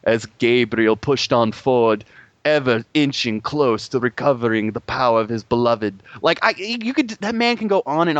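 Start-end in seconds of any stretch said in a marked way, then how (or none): 0.0-6.0 mock grand audiobook narration; Patrick Stewart narrating. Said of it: "listening to somebody read me something".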